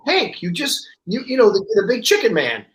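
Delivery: playfully incredulous husband; mock exasperated insisting. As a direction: retell trying to jog someone's memory, pitch lifted. Retold husband insisting to disbelieving wife.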